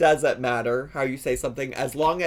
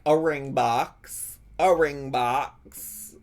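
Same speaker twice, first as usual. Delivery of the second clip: slow mocking repeat; much slower than usual. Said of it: "and was like".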